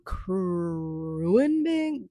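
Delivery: "Kruenmin" very slowly sounded out; hesitant, stretched attempt at "Kruenmin"; unsure pronunciation. Real, not acted. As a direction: drag out the "Kruuu" of "Kruenmin", feeling for it. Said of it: "I don't even know how to pronounce this".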